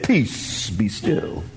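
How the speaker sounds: low and soft, calming the sea; hushed command. Acted. Jesus speaking to the sea, low and soft. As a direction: speak peace over the water, hushed.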